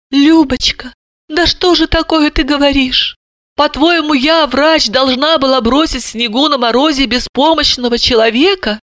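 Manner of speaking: high-pitched, fretful wailing; frightened lament. A narrator's reading. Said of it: "испуганно запричитала".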